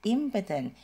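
"Impotent" with the first syllable IM hit hard; stress on impotent first syllable. Shown as a demonstration. Teacher stressing the first syllable of impotent for learners. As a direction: lean on IM in "Impotent", then let the rest fall. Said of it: "ini bacanya e ya".

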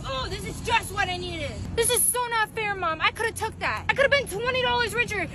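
usual speaking voice

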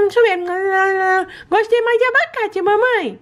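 high, sweet little pet voice begging for treats; baby-voiced pet. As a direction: talk like a greedy cute puppy asking mommy. Lilo tastes treats.

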